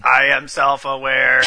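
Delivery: slow, flat robot voice; a machine announcing itself. Pretend. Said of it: "Vista, what are you doing?".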